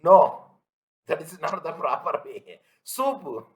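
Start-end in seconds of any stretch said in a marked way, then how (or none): 0.0-0.5 sudden loud, firm no. Real, not acted. Teacher rejecting a student's answer with a loud no. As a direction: cut the wrong answer off sharply.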